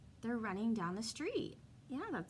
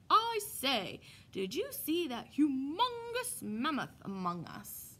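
loud, slow, booming giant; 4 semitones higher, 6 dB louder, slower than usual. Acted. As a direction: boom it out like a giant. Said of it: "the way giants must talk".

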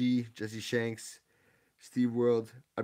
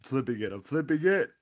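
excited; 3 semitones higher, 4 dB louder than usual. Real, not acted. Excited card flip.

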